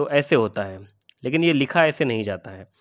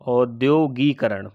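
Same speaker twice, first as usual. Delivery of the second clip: औद्योगीकरण drawn out slowly, syllable by syllable, falling; slow spelling demo; much slower than usual. Shown as a demonstration. Teacher shows students the wrong form, said very slowly. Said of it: "अगर आप ऐसे लिखते हैं".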